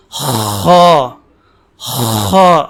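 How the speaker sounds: خا full-mouthed and low, smooth with no rasp; smooth heavy kha. Shown as a demonstration. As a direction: keep خا smooth, never gritty. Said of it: "میری آواز کے اندر آپ note کریں". (خرخراہٹ کے بغیر ہموار خا)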